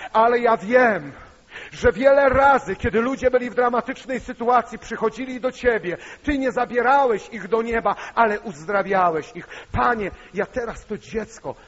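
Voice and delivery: building to a high, loud plea; fervent prayer. Retold or imitated. Brother prays for dying nephew, rising fervent prayer. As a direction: pray rising to full fervour.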